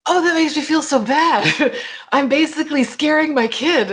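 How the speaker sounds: high, dismayed mock parent voice; imitating a stricken parent; parents' dismay. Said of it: "they're like".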